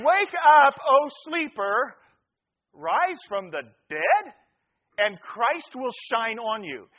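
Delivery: loud opening, slow measured phrases; declaimed scripture. Real, not acted. Preacher reading Ephesians to the congregation, declaiming. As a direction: proclaim the verse phrase by phrase, with pauses. Declaims the verse after joke.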